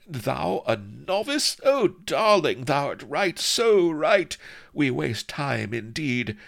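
high, slow, swelling rapture; high-pitched, thrilled mistress; ecstatic. A narrator's reading. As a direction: gush rapturously, very high and drawn out. Thrilled mistress praises his kiss.

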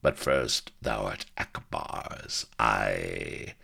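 low, slow villain; leering growl. A narrator's reading. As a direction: gloat slowly in a deep growl. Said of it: "he growled, leering".

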